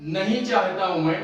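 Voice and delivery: ordinary delivery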